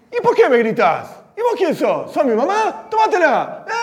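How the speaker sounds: high, cheeky talking back; defiant retort. Retold or imitated. Feisty girl standing up to the teacher, defiant high retort. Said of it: "otra que es más guerrera se va a parar".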